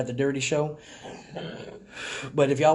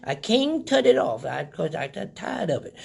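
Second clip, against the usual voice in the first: a higher voice, playing a dotty old lady; confused old lady; 6 semitones higher than usual. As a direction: pick up the phone like a muddled grandma. Pranking the telemarketer as old lady.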